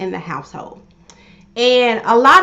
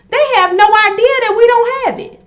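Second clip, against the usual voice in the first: emphatic; 12 semitones higher, 6 dB louder than usual. Real, not acted. Emphatic point to viewers.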